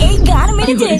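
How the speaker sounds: loud sudden exclamation of shock; loud alarmed gasp of a line; shocked outburst. Acted. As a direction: blurt it out, stunned. Shocked at the news.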